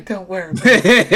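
put-on co-host repeats the British-accented line, laughing; hammed-up British accent. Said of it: "that accent she's putting on".

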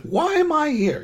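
high-pitched mock exasperation; mock whiny complaint. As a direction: squeak it out in disbelief. Retelling Negan's complaint.

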